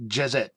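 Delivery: "Jezet" said as juh-ZET; pronunciation demo. Shown as a demonstration. Reader showing how he would pronounce it, "Jezet" as juh-ZET. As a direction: sound out "Jezet" with a short e in the last syllable. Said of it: "That's how I would pronounce it".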